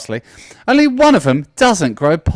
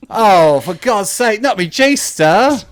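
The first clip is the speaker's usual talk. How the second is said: exasperated; 7 semitones higher, 4 dB louder, much slower than usual. Acted. Shirt torn by magpie.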